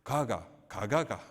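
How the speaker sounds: slow, clipped Gyeongsang dialect syllables. Retold or imitated. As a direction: drop the syllables slowly like a Gyeongsang local. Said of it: "경상도 사람은".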